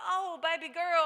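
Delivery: loud, high outpouring of affection; moved mother. Retold or imitated. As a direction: exclaim it to your child, swept up with feeling. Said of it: "And I'm like".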